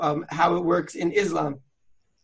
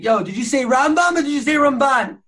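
higher, louder, pushy challenge; hammed-up quizzing of a friend; mock yeshiva challenge; 7 semitones higher, 5 dB louder than usual. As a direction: call him out like a yeshiva guy arguing. Mock yeshiva argument over names.

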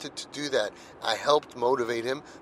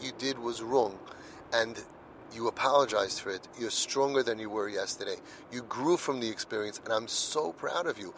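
put-on softer and lower, quietly affirming the boy; tender fatherly pride, fading softer; quiet affirmation; 4 semitones lower, 7 dB quieter than usual. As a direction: gently tell him he grew stronger.

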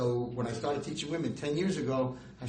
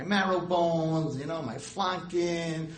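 savoring; 4 semitones higher, 4 dB louder, much slower than usual. Real, not acted. Savoring his shopping.

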